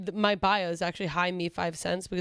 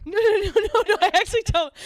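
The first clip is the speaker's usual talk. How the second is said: high, loud, laughing denial; loud laughing protest; 10 semitones higher, 8 dB louder than usual. Real, not acted. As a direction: squeal it out between laughs.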